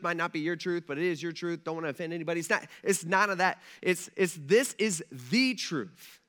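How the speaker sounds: ordinary delivery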